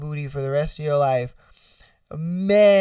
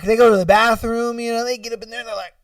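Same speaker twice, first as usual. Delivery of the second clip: usual speaking voice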